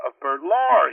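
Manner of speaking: ordinary delivery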